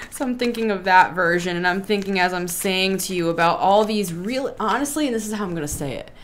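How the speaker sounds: ordinary talk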